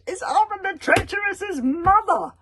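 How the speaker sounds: high loud shocked shout; boy recognising the witch, horrified; horrified cry. A narrator's reading. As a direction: blurt it out in terror.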